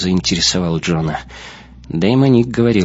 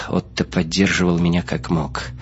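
plain reading voice

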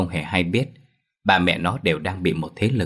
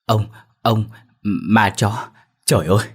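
terrified stammer; 2 semitones higher, 4 dB louder than usual. A narrator's reading. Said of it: "sợ hãi nói không tròn câu".

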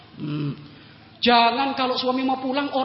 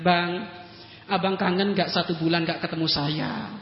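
sweet wife; 5 semitones lower, 5 dB quieter than usual. Retold or imitated. Wife sweetly asks husband.

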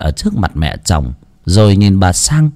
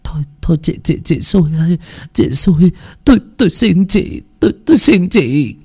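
begging; 6 semitones higher than usual. Acted. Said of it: "van vỉ".

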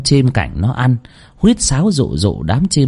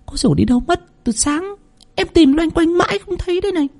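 put-on much higher, sighing frightened servant girl; sighing maid; 4 dB quieter than usual. Maid sighing to Nam that she can't find the girl, high and weary. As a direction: sigh it out, worn and worried. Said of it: "thở dài".

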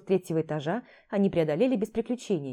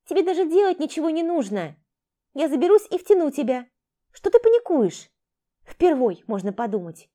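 higher, louder impatient thief; bossy thief voice; 8 semitones higher, 7 dB louder than usual. Acted. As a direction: push him along, scornful.

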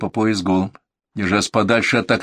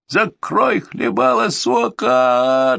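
high, very slow drawn-out howl; drawn-out yell; 12 semitones higher, much slower than usual. Acted. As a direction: stretch the curse into a pained wail. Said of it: "Длина вопля пациента".

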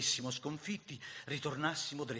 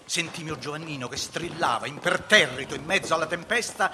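loud, raised, driving recitation; heated battle narration; 5 semitones higher, 10 dB louder than usual. A narrator's reading. Narrator telling of the firefight at the cottage, loud and urgent. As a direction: tell the battle over the din of gunfire.

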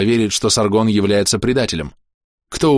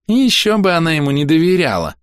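high, sneering drawl; mocking, insinuating singsong; nasty drawl; 10 semitones higher, 3 dB louder than usual. A narrator's reading. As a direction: draw it out with a nasty smile. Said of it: "протянула Хоули, гадко улыбаясь".

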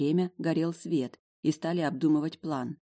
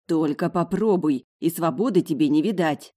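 plain reading voice

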